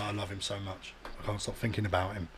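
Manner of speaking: low, very soft deadpan confession; mock-sincere, hushed and low; feigned devotion. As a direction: quietly fake a love of Jesus.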